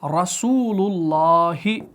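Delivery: रसूलुल्लाही recited very slowly, र heavy; heavy ra recitation. Shown as a demonstration. Teacher writing an example, slow recited heavy ra. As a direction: chant रसूलुल्लाही with a thick र. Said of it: "मोटा पढ़ा जाएगा".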